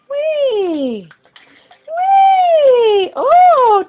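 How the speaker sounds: high, loud joyful squeal; excited whee. Real, not acted. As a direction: squeal it with delight. Grandma splashing baby playfully.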